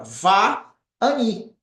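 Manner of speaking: Va-ani said slowly in two parts, va then ani; model pronunciation. Shown as a demonstration. Teacher modelling Va-ani for students, careful and clear. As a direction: spell out Va-ani syllable by syllable. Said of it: "A pronúncia dele".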